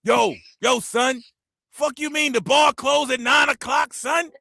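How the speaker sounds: high, loud whiny New York complaint; high New York accent complaining; complaining New Yorker. Retold or imitated. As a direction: gripe like an outraged New Yorker. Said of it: "they always complain".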